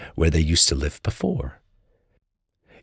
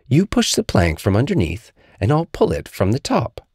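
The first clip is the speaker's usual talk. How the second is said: bossy, careful Little Tiger; 7 semitones higher than usual. Acted.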